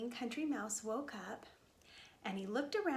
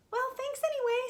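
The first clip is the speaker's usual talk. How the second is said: high, louder mouse voice; polite little mouse; 13 semitones higher, 6 dB louder than usual. Acted. Mouse thanks the lambs before leaving, high and polite.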